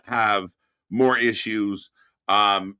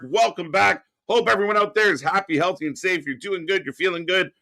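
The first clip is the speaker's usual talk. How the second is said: usual speaking voice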